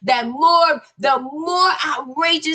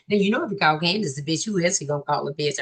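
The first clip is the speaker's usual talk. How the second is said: low, very fast, hushed; sly aside; 8 semitones lower, 5 dB quieter, much faster than usual. Acted. Host slips in a remark, quick low aside. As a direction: rattle it off under the breath.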